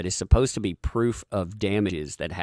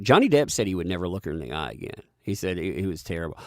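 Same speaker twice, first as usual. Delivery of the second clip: normal talking voice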